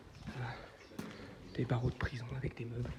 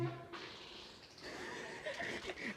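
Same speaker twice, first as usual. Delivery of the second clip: soft laugh; 10 dB quieter than usual. Real, not acted. Laugh heard.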